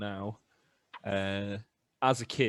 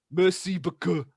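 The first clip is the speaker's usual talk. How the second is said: loud, high Mancunian French thank-you; Manchester accent; 9 semitones higher, 10 dB louder than usual. Retold or imitated. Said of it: "with a thick Manchester accent just goes, top of his lungs".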